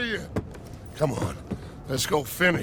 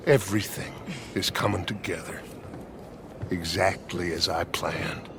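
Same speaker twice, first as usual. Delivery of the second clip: low gloating murmur; 13 semitones lower, 7 dB quieter than usual. Acted. Gloating aside about his plan.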